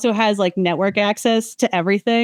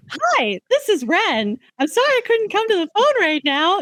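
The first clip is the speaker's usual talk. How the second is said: imitating a high-pitched voicemail greeting; mock sing-high answering-machine voice; 11 semitones higher than usual. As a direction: pitch it way up, mimicking a phone greeting. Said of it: "it does start very high pitch".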